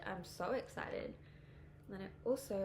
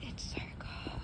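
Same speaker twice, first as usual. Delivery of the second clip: whispering. Real, not acted. Shivering outside in cold.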